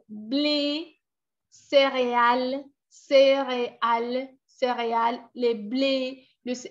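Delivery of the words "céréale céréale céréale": "céréale" said slowly, each syllable clear; slow repeated word. Shown as a demonstration. Video voice repeating "céréale" for learners. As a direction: stretch "céréale" out for students to copy. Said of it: "miren cómo la pronuncian".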